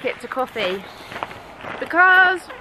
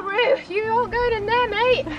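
ordinary talk

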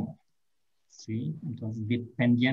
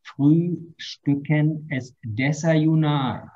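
slow teaching pace; much slower than usual. Shown as a demonstration. Teacher translating a new verb for students, slow and clear. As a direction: lay the word out slowly for the class. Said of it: "la pronunciación".

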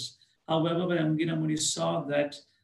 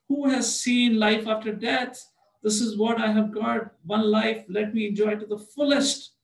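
boastful worldly man; 5 semitones higher, 4 dB louder than usual. Retold or imitated. Worldly people's boast quoted.

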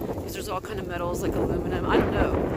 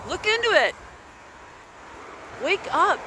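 shouting at viewers; 9 semitones higher than usual. Real, not acted. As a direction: yell it at the viewers to wake them up. Urging viewers to wake up.

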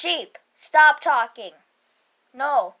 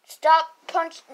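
usual speaking voice

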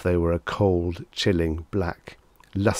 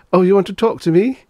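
high, loud, silly surprise; sheepishly clueless question; foolish; 12 semitones higher, 10 dB louder, faster than usual. A narrator's reading. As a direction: play dumb in a pitched-up voice. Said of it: "he said foolishly".

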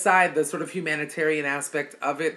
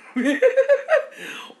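shrieking burst of laughter, much higher; high squealing laugh; 6 dB louder than usual. Real, not acted. Cracking up at her own chitlin story, squealing laugh.

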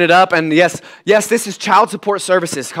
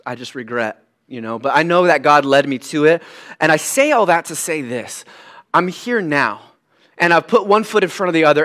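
ordinary talk, nothing marked

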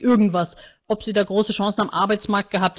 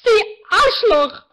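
much higher and louder, slow, tearful scream; 11 semitones higher, 6 dB louder, much slower than usual. A narrator's reading. Martina screams at the counselor. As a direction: explode at him, in tears. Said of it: "schrie Martina".

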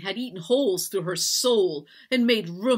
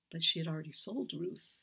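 usual speaking voice

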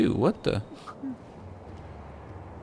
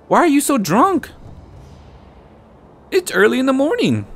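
rising higher and higher, impatient scolding; teasing a drunk guard, climbing higher in pitch; mock nagging; 8 semitones higher than usual. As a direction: nag him, getting shriller each line. Player mocks drunk enemy, rising pitch.

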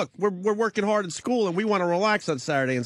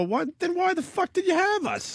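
mock defiant kid. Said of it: "I used to go".

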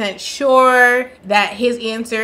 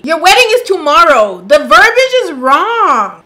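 louder, stressing wedding in disbelief; exasperated emphasis; 5 semitones higher, 7 dB louder than usual. Real, not acted. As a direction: hammer home "wedding" incredulously.

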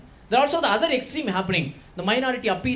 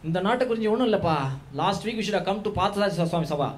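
put-on Tambram mama accent; much faster than usual. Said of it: "Tambra mamas will make it into a single word".

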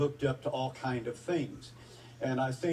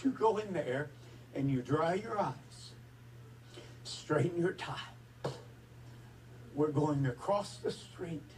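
choked up. Real, not acted. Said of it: "it affect me like this".

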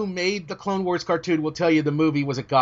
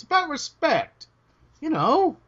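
mock indignant; 9 semitones higher, slower than usual. Mock offended dignity.

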